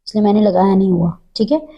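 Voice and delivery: ordinary talk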